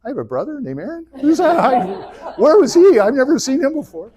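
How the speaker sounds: high, loud, laughing astonishment; can't believe he has a brother; laughing disbelief. Retold or imitated. Moses, hearing about Aaron, in laughing high disbelief. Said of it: "And Moses is like".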